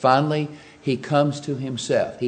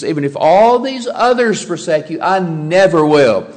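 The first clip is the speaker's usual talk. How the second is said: bold boast; 4 semitones higher, 7 dB louder than usual. Retold or imitated. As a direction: proclaim it like a bold disciple. Peter's boast retold in sermon.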